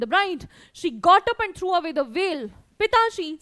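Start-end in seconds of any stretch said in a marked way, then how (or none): 2.8-3.4 raised, higher voice ringing out clearly; clear, loud voice. A narrator's reading. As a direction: call out to father, loud and clear. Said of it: "said Bholi in a clear, loud voice".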